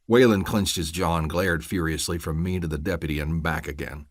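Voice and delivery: ordinary delivery, nothing marked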